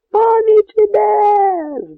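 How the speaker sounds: very high, loud, slow drawn-out cry; cossack shouting. Acted. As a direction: bellow it from the walls. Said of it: "знов крикнув козак".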